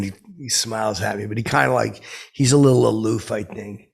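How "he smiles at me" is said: ordinary delivery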